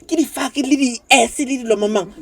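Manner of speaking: high, mocking parent voice; imitating a disapproving parent. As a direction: play the scandalised parent. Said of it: "But they're like".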